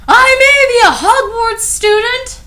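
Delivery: high, loud mock fury; over-the-top villain rage; hammed-up angry. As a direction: growl out the defiance. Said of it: "Hagrid paused angrily".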